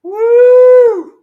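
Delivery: high, drawn-out "woo"; loud whoop. Real, not acted. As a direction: whoop at full volume, holding the note. Fired up, whoops before a toast.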